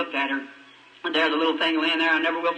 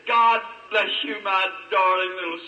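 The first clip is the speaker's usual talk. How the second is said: tender blessing; 2 semitones higher, slower than usual. Retold or imitated. Father blessing his dead baby.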